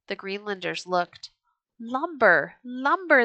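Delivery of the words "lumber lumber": higher, louder joyful cry; excited cry. A narrator's reading. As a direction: shout it out, thrilled at the timber. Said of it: "they cried".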